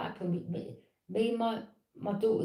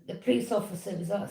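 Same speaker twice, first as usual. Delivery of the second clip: usual speaking voice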